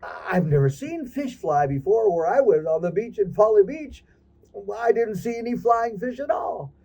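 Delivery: high, amazed crab exclaiming; surprised. Acted. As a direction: crab amazed at flying fish, near shouting. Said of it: "he goes".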